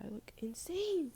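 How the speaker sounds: high whisper of self-horror; whispered dismay. Real, not acted. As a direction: gasp it out in disbelief. Reacting to own appearance.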